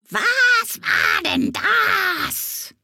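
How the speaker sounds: very high, slow screeching parrot cry; parrot shriek. Acted. Parrot Camillo shrieking from the treetops, drawn-out screech. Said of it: "dieses Gekreische".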